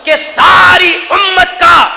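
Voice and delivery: rising in pitch toward a high finish; building preacher's climax. Real, not acted. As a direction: build steadily to a ringing climax.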